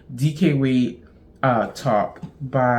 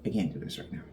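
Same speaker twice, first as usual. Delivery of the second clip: a much lower, softer repeat of the line; low, much softer mutter; 10 semitones lower, 13 dB quieter, much faster than usual. Real, not acted. Repeats line, then drops away.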